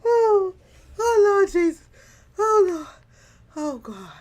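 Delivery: high, loud laughing cries of Oh Lord Jesus; wailing through real laughter; barely breathing from laughing; laughing hard. Real, not acted. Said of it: "I'm laughing so hard on the inside".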